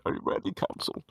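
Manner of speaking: whispered judge question; whispering. Acted. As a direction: breathe it as a whisper.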